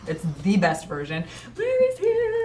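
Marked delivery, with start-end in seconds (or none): 1.6-2.4 high, singsong announcement; excited greeting. Real, not acted. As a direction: sing out that a friend arrived. Greets someone arriving.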